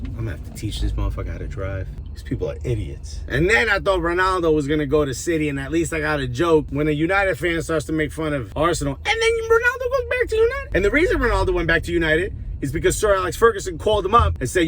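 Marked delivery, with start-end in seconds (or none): 0.1-3.2 low, quiet grumble at other drivers; quick low aside, fed up; muttered annoyance. Real, not acted. Muttering about a stuck driver. 9.1-10.7 high, fast squeal of disbelief; squeaky, rushed dismay; incredulous outburst. Real, not acted. Dismay at Ronaldo joining United.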